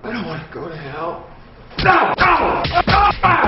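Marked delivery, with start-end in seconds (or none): 1.8-3.4 high, desperate screams of terror; panicked screaming. Acted. Terrified suspect begging, screaming.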